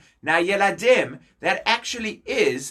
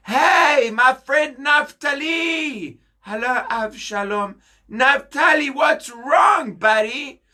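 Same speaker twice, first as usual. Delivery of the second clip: hearty greeting; 7 semitones higher than usual. Acted. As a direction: call out like a schmoozing best friend. Avshalom greets sad friend loudly.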